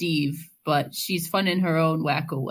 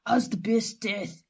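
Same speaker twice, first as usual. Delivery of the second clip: hammed-up Austrian action-star accent; Schwarzenegger voice; 4 semitones higher than usual. Podcaster trying a Schwarzenegger read of listener mail, strained Austrian accent. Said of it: "I lost my Schwarzenegger voice".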